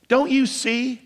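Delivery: usual speaking voice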